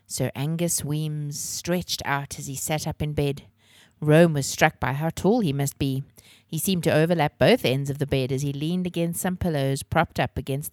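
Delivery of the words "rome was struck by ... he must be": usual speaking voice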